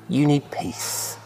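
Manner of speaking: slow, quiet and tender; gentle reassurance. Acted. Jesus to disciples behind locked doors, gentle. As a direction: say it softly to calm them. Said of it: "He says".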